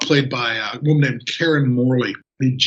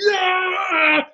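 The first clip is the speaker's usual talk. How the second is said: mock crowd cheer. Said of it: "And they all go".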